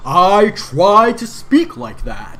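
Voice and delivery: slow, loud mock announcer; put-on announcer voice. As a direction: play it big and drawn-out like an announcer. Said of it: "I'm gonna talk like that".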